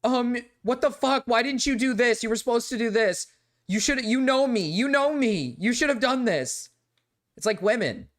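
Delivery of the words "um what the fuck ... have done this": higher-pitched mock nagging girlfriend; a hint of an indignant woman; mock angry woman. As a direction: play the annoyed partner who expected you to read her mind. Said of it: "because she's like".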